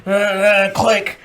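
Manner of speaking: hammed-up recruit shouting into the phone; frantic phone message. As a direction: blurt a forced recruit voicemail. Acts out frantic scripted phone message.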